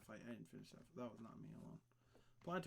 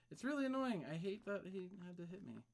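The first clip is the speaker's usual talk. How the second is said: whiny complaining; 6 semitones higher, 5 dB louder than usual. Pretend.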